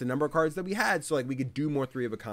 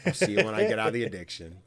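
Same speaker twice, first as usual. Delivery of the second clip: dry thanks; 2 semitones lower than usual. Retold or imitated. Mock weary thanks.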